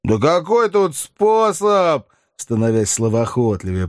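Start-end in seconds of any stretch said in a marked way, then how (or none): 0.0-2.1 Sharikov voice. A narrator's reading. Sharikov voiced, tipsy after vodka.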